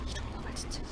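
unvoiced muttered whisper. Real, not acted. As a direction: breathe it under your breath. Muttering to self in game.

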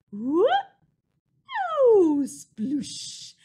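loud whoop then splashy sploosh; silly splash sound. Shown as a demonstration. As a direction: shout it like jumping into paint. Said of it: "silly sound ... for all of the times the mice jump".

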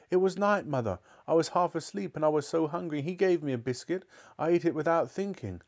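high, apologetic confession; pleading boy. Acted. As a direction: excuse yourself to your mother. Boy confesses to his mother.

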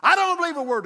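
high, loud shout from the back; imitated heckling yell; heckler. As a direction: holler disbelief like a heckler. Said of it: "a heckler back there in the back says".

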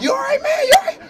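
very high, squeaky concern; high-pitched mock alarm; alarmed teammate. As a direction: ask it in a high, worried yelp. Teammate checks on hyperventilating rookie.

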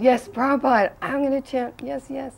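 high-pitched, hasty-to-please agreement; eager assent. Retold or imitated. Nervous disciple promising the guru to chant, high eager assent. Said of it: "So then I said".

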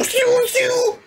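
high squeaky shoo sound effect; imitated blast noise. Reactor imitating anime edit sound effects, high squeak. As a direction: mimic an anime attack sound. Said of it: "they just start shoo".

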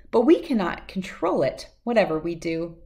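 ordinary delivery

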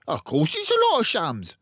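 pompous father voice; loud pompous father. Acted. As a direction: boom it out like a showy salesman.

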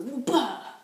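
loud effortful whoop; dynamic climbing grunt. Retold or imitated. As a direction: heave up with a big whoop. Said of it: "someone who's dynamic would go".

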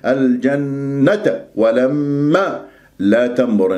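الجنة with a long nasal hum, no stress; ghunna without stress. Shown as a demonstration. Teacher showing ghunna to students, slow. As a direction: hum the nun of الجنة smoothly, unstressed. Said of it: "أعطيها غنة من غير نبر".

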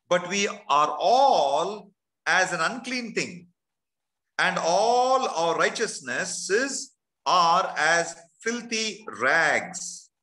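drawn-out, very slow scripture reading; very slow reread. Shown as a demonstration. Preacher rereading the verse so the congregation hears it, very slow. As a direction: stretch every word of filthy rags. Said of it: "Let me read that again".

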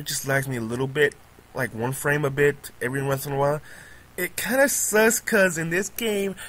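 ordinary delivery

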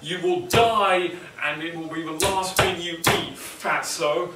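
loud, slow, menacing threat; harsh mock warning. Coach threatening the obese class, slow mock menace. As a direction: deliver it like a grim doomsday coach. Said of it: "look them dead in the face and go".

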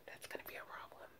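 breathy whispered aside; whispered, half to herself. Real, not acted. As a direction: drop to a whisper on the punchline. Whispered aside after nervous talk.

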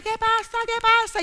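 voz de madre mucho más aguda y alarmada. Acted. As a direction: pregunta nerviosa como una madre asustada. (madre asomada, aguda y alarmada)

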